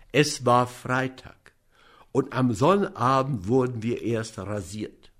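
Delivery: plain reading voice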